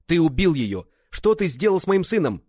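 high, loud, grief-stricken shouting; enraged accusation. A narrator's reading. Father accusing friend of killing his wife, enraged shout. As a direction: hurl the accusation at full voice.

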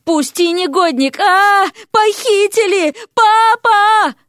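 high, loud, drawn-out cries for help; panicked screaming. A narrator's reading. Kidnapped Vasya calls for her father, panicked scream. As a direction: build from indignant demand to shrieking for rescue.

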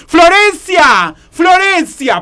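grito agudo llamando a alguien; llamá fuerte a tu sobrina, estirando el nombre; llamado a gritos. Acted. (gritando para que venga)

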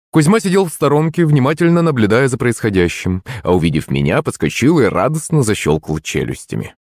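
usual speaking voice

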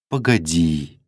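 low-key, composed interruption; calm. A narrator's reading. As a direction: stop him calmly, without pushing. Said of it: "спокойно остановила его Саша". (спокойно, ровно)

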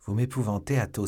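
ordinary delivery, nothing marked

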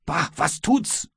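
captain mockingly repeats the sailor's shrug, appalled; indignant echo. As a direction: throw the words back in disbelief. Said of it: "und du antwortest mir".

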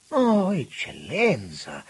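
high, slow, drawn-out denial; horrified astonishment. A narrator's reading. Auriti denies to the minister, aghast. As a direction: stretch it out in horror. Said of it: "negò subito con sbalordimento d'orrore".

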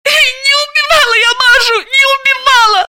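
high, loud, crying shriek; shrieking denial. Acted. Accused sister denying the murder, crying shriek. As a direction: scream it through tears. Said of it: "Заматаева взвизгнула".

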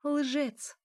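high-pitched, falling one-word insult; angry accusation. A narrator's reading. Elya accuses Mikhail after a silence, angrily.

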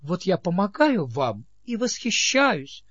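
gushing, eager and high; fervent. A narrator's reading. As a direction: gush with admiration. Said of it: "пылко сказала Нинет".

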